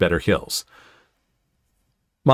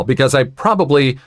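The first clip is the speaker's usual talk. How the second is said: ordinary delivery, nothing marked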